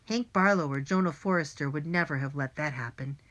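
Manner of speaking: ordinary delivery, nothing marked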